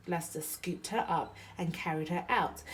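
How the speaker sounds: ordinary delivery, nothing marked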